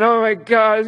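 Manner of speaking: hammed-up worry over his teaching; mock worried. Husband playing up his teaching worries to his wife. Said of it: "all worried about my teaching".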